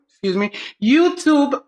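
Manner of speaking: usual speaking voice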